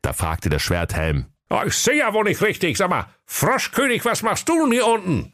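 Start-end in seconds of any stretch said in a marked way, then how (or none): 1.5-5.3 high-pitched grumbling sleeper-car conductor; comic high fool griping; high sulky conductor. Acted. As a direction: whine about the job in a high silly voice. Schwerthelm voice.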